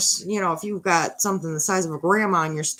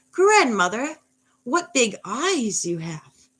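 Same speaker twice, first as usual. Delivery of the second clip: put-on high, slow storybook girl; proper recital of the girl's famous line, drawn out; Little Red; 6 semitones higher, 3 dB louder, much slower than usual. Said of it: "actually what she says is".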